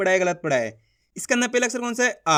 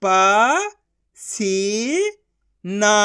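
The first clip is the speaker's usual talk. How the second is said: slow, each syllable of प-सी-ना pulled apart; teacher sounding out a word; much slower than usual. Shown as a demonstration. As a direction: sound out पसीना as प, सी, ना with gaps. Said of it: "एक एक अक्षर का उच्चारण करके".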